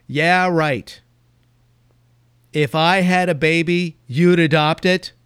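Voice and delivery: loud mocking shout; jeering shout from the steps. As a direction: yell it back in scorn. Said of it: "shouted, almost screamed".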